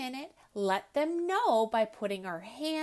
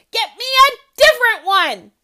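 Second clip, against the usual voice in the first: much higher, loud tantrum shout; 11 semitones higher, 19 dB louder, faster than usual. Acted. Ellie throwing a tantrum at her father, shouting. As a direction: scream it in a full tantrum. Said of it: "she shouted".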